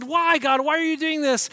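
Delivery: loud, high-pitched protest to God; crying out. Acted. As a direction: demand an answer from heaven. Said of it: "cried out and they said".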